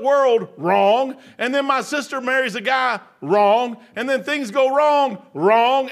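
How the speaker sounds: loud, slow scolding with every wrong hit hard. Retold or imitated. Son scolding his mother for blaming God, loud and slow. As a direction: lay out every wrong to her, building loud. Lecturing mom in retold argument.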